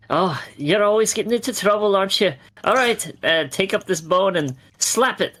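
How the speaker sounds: woman character. Acted. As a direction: play a woman chiding, voice lifted. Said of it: "a woman this time".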